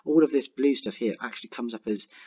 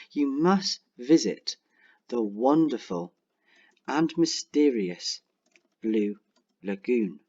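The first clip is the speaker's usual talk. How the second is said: slow persuasive dictation; slower than usual. Shown as a demonstration. Teacher dictating persuasive sentence.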